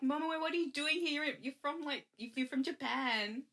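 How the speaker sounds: high, fast mock surprise; surprised greeting. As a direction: gush at seeing an old friend. [name] surprised to see friend.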